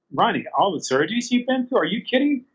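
a high, amazed "are you kidding?"; incredulous. Retold or imitated. To Ronnie, brushing off his praise: high incredulous voice.

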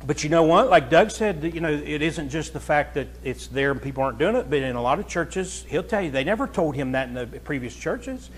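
ordinary delivery

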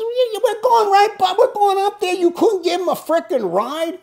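husband mimicking angry wife, high and loud; mock wife voice. Wife scolding him in retold argument.

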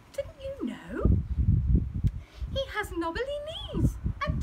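high mouse voice spinning a scary tale; scheming mouse. Acted. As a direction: spook the fox with a high mouse voice. Mouse describes Gruffalo.

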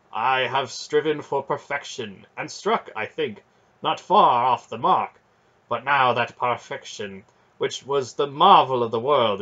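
a noble knight ashamed of his fear; grave knight lament. Acted.

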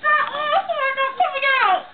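high, tearful whining; high crying complaint, rushing at the end; whiny distress. Acted. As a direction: plead through near tears, voice climbing. Distraught over botched implants.

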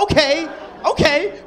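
high, loud, slow okay; eager agreement. Retold or imitated. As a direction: agree with gusto. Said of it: "I'm like".

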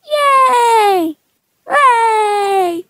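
high squeals of joy; squealing cheer. Acted. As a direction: squeal with delight. Character celebrates.

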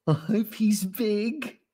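high, slow, hopeful voice; swooning female gorilla. A narrator's reading. Gidget pictures the new male, swooning. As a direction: wish for it dreamily.

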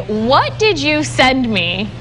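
ordinary talk, nothing marked

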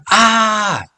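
loud high shriek of horror; horrified scream. Acted. Ali sees her new haircut in the mirror, horrified scream.

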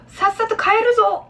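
high, drawn-out insistent order; acted emphatic command; forceful command. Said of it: "강하게 말을 할 때".

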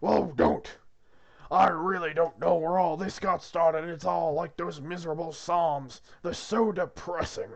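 loud, irritated God voice; exasperated God. Acted. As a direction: boom it out as a fed-up Lord. Plays God scolding Arthur.

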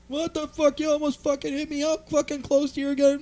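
high-pitched angry mimicry of a cop; mock a cop flipping out in a high voice; high, ranting cop caricature; flipping-out cop impression. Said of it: "completely flips on me".

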